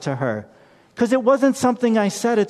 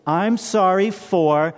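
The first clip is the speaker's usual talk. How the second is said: slow, deliberate "I'm sorry for"; slow sincere apology; much slower than usual. Shown as a demonstration. A parent teaching kids to apologize, slow and sincere. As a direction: say "I'm sorry for" slowly, meaning it. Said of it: "How do you apologize? You say".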